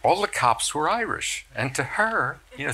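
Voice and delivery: usual speaking voice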